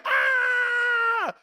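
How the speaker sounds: high, thrilled scream at the top of the ride; roller coaster scream. Retold or imitated. Preacher screaming on the coaster's peak with delight. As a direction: shriek with the drop coming. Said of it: "and I was screaming".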